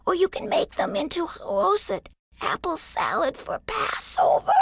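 little tree begging Yamo for water; tiny apple tree. A narrator's reading. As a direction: plead tiny and high, fading to a whisper. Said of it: "said the tiny apple tree".

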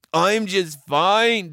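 high, slow reply; placating little brother. A narrator's reading. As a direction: answer big sister meekly. Joe answers his sister.

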